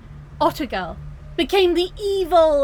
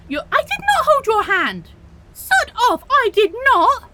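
louder and higher, building to a shouted how dare you; blustering to cover embarrassment; flustered angry denial; 6 semitones higher, 6 dB louder than usual. Acted. Villain denying she held the hero's hand, flustered and angry.

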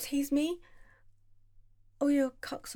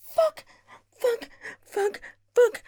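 much higher, louder yelp; high climax cry; 11 semitones higher, 6 dB louder, slower than usual. Acted. Peak cry.

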